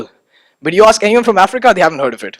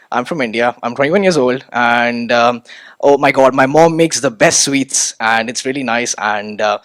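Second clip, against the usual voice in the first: lower, hesitant, mock-flustered self-introduction; awkward rambling intro; 7 semitones lower than usual. Speaker mocking a clumsy first meeting, lower hesitant ramble. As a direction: lightly mimic a nervous newcomer rambling on. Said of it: "Do you really wanna sound like that".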